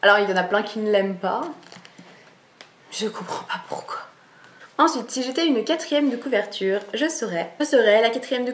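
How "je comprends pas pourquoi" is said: soft whispered aside; hushed, puzzled aside. Real, not acted. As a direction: drop to a whisper, half to yourself. Aside about Snape's haters.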